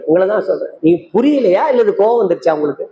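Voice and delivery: ordinary delivery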